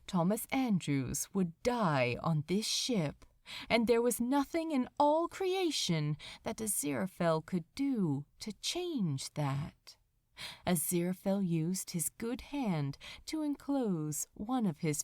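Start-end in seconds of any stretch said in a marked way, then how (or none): none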